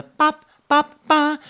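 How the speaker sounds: high little game-music bops; imitated game sound. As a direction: pop out bouncy high syllables. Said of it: "when I saw New Super Mario Brothers Wii".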